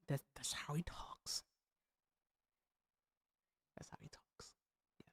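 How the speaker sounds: hushed aside, almost no voice; whisper. Real, not acted. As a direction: drop to a breathy whisper as an aside. Whispered aside about Trump's speech.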